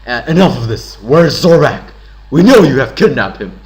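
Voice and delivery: loud, forceful demand; aggro strong voice. Acted. Hero confronting captor about Zorbak, aggressive strong voice. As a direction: hit it hard like an angry accuser. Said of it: "agrotone, that strong voice".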